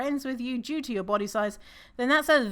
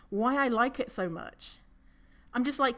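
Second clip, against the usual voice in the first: ordinary talk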